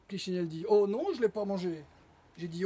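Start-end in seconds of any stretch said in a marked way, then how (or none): 0.7-1.8 louder, swooping "non"; stubborn puppet-show contradiction; pantomime denial. A narrator's reading. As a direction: deny it with theatrical insistence. Oh-yes-oh-no quarrel.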